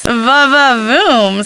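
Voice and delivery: slow, drawn-out swoon. Acted. Lisa gushes to Nancy about the ski instructor's looks, swooning. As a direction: savour it, slowly and appreciatively.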